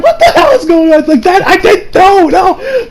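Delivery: high-pitched mock panic; squeaky, hammed-up bewildered denial; flustered protest. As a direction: imitate someone defending himself in a fluster. Flustered Deku defending himself under attack.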